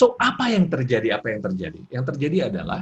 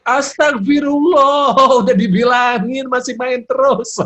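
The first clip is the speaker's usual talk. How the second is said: mock furious parent, high, loud and drawn out; angry raised voice; 10 semitones higher, 9 dB louder, slower than usual. Shown as a demonstration. Parent scolding child still playing, hammed-up anger. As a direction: explode in exaggerated scolding wail. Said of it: "jangan langsung marah, jangan naik".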